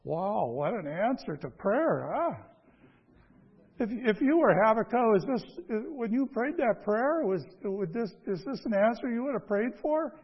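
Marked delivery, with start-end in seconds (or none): none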